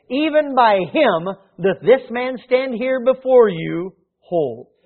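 steady formal reading of scripture. Acted. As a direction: read the passage with weight. Reads scripture aloud.

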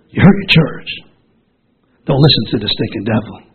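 ordinary talk

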